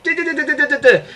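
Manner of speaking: high staccato vocalised riff; imitating an instrumental. Gushing about the opening music, imitating the instrumental. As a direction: mimic the instruments in quick syllables. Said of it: "The instrumental there, like".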